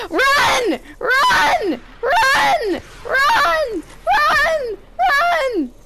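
high, loud panicked yells; panicked shouting. Real, not acted. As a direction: scream at them to run. Fleeing the storm, yelling.

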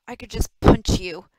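loud, slow angry growl. A narrator's reading. Maddie threatening Rick, growled. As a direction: lean hard and slowly on the threat. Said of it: "Maddie growled".

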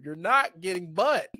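usual speaking voice

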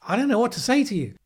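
normal talking voice

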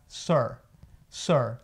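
"sir" com som de U e R enrolado. Shown as a demonstration. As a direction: say "sir" with a curled-back UR sound. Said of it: "o I R tem o som de U R". ("sir" com R enrolado na garganta)

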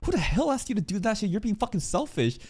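high, quick mock rebuke; Amelia snapping. Streamer voicing Amelia scolding him, high quick mock. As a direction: fire it back high and fast. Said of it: "Amelia's like".

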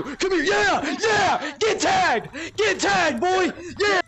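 high, loud gloating; triumphant shouting. Real, not acted. Tagging a fallen rival, triumphant yells. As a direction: celebrate the tag at full volume.

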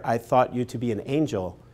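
ordinary talk, nothing marked

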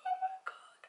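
very high, hammed-up tearful squeal; mock crying. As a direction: wail it as if overcome by cuteness. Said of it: "And I'll start crying".

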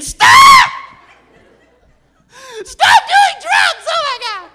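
put-on high hysterical shrieking girlfriend; mock girlfriend screaming in a loud high voice. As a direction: shriek at him like a frantic girlfriend. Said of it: "she's screaming".

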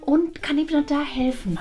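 normal talking voice

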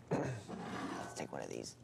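hushed, very soft and low; barely voiced murmur; whispering. Real, not acted. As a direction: whisper while picking something out. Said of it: "I'll whisper".

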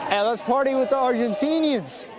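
usual speaking voice

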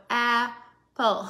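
apple, very slow, two chin drops; slow syllable demo. Shown as a demonstration. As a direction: drag apple out into two chunks. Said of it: "they can feel their chin move".

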